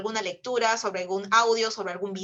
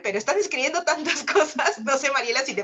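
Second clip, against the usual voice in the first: laughing overwhelmed; 4 semitones higher, 5 dB louder, faster than usual. Real, not acted. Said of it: "abrumada por el chat".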